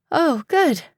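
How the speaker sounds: a touch of trembling relief; shaky voice. Acted. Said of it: "Paige said in a shaky voice".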